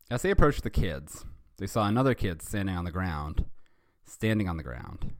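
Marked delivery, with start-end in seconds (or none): none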